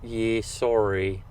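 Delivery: slow Australian "yeah, no" dithering; mock Australian accent. Host answering the bathroom question as a local, mock Australian accent. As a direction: trail through a caricature Australian non-answer. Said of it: "That's how Australians say no".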